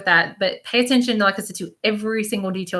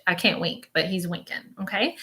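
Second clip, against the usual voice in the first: usual speaking voice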